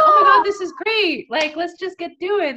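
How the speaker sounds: very high, loud gushing; thrilled partygoer shouting; excited squeal. Retold or imitated. Staff excited about the party.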